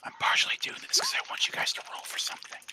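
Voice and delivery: fast unvoiced whisper. Real, not acted. GM confessing to players why they roll, whispering fast. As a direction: confide it quickly under your breath.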